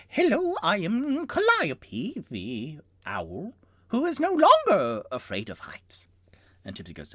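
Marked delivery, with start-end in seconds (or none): 0.1-6.1 high, slow owl turning to a whisper; proud owl, breathy at the end. Acted. Owl introduces itself.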